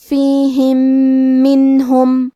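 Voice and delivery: فیہم منہم joined with a nasal doubled م; merged mim demo. Shown as a demonstration. Teacher demonstrating mim merging to students. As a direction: glide فیہم into منہم with ghunna. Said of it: "ادغام تو ہم پہلے میم کو دوسرے میم".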